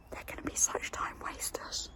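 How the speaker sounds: hushed, voiceless whisper; real whisper. Real, not acted. Whispering by the sleeping cats, hushed whisper. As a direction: whisper it very softly. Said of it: "Why am I whispering?".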